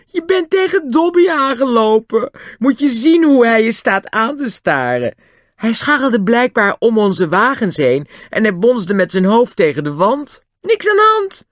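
very high, loud amused exclaiming; laughing hard. A narrator's reading. Dick teases Julian for bumping into Dobby, laughing hard. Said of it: "begon keihard te lachen".

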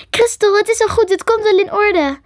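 high, loud anxious reassurance; calling out. A narrator's reading. Ellen to wounded Crystal, frantically reassuring. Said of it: "riep Ellen".

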